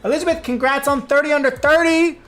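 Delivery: high, loud, thrilled shout-out; excited greeting. Real, not acted. Streamer spots a successful friend in chat, excited greeting.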